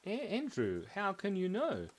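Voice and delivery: high-pitched, astonished character voice; mock bewilderment. Apple questioning his friend Andrew, mock bewilderment. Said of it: "he changed his facial expression".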